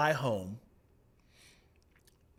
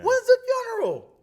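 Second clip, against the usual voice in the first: much higher, louder startled cry; shocked disbelief; 13 dB louder than usual. Retold or imitated. Miss Calhoun hearing of the death, high shocked imitation. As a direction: gasp it out in disbelief.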